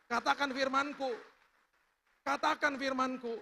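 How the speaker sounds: high, loud preaching command; forceful proclamation. Acted. Preacher voicing God's command to the church, forceful. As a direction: ring it out over a congregation.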